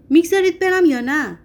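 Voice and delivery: loud, high-pitched demand; terrible shout. A narrator's reading. Patient demands nurses let him go, shouting fearsomely. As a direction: shout it as a threatening ultimatum. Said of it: "با صدای وحشتناکی فریاد زد".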